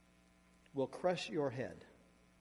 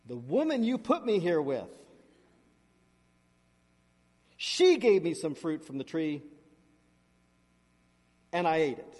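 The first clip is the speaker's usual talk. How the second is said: defensive Adam; 9 dB louder than usual. Acted. Adam blaming the woman.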